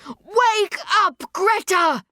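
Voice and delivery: high, urgent holler; high-pitched desperate shout. A narrator's reading. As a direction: holler to shake a sleeper awake. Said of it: "Yukio hollers".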